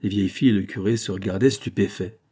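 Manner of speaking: plain reading voice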